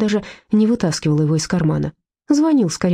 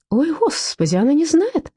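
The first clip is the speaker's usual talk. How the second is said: mock womanish, higher pitch; hammed-up rising womanish wail; по-бабьи; 10 semitones higher than usual. A narrator's reading. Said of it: "по бабе всплеснул руками". (по-бабьи, высоким голосом)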